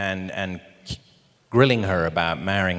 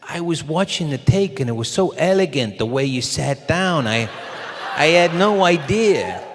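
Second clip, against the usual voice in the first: higher, louder admiring director impression; Rob; 7 semitones higher, 4 dB louder than usual. Director amazed by his actor's take, imitated higher voice. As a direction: gush in a put-on pitched-up voice. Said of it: "And Rob says".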